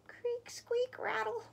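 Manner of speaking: high, squeaky sound-effect words; creaky bed noises. A narrator's reading. Mouse hops into his bed, high creaking noises. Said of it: "went Mouse's bed".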